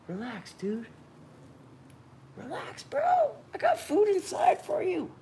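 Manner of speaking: high, sing-song coaxing voice; coaxing the lizard. Real, not acted. Owner coaxing his snapping pet, high cooing. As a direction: sweet-talk the animal to calm it.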